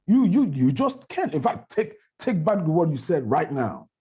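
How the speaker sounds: high-pitched, heated objection; emphatic, offended pushback; indignant confrontation. Acted. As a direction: challenge the accuser and demand a retraction. Acting out argument over scam accusation.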